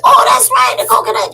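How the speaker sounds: a sharp high cry of realization; sudden recognition. Acted. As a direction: burst out remembering. People suddenly remember.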